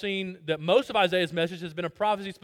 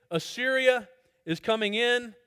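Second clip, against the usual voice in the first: ordinary talk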